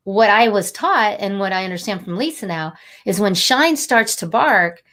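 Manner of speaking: ordinary delivery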